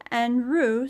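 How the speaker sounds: ordinary delivery, nothing marked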